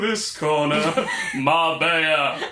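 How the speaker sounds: slow ring announcer call; hammed-up ring announcer; boxing announcer. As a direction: drag it out like a fight intro.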